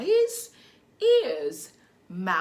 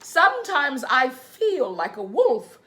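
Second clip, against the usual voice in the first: put-on reading to children; 3 semitones higher, slower than usual. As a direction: read gently to little ones. Reads picture book to children.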